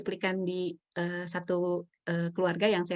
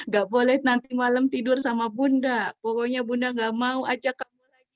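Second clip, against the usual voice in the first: louder, higher scolding mom; mock parental threat; 5 semitones higher, 7 dB louder than usual. Mother threatening child with punishment, mock scolding. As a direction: lay down the law like a fed-up mother. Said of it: "Wah, ancaman".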